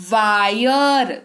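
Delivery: "वायर" stretched slowly so the आय glide is heard; slow clear "wire". Shown as a demonstration. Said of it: "सुनाई दे रहा है?". ("वायर" को धीरे खींचकर बोलना)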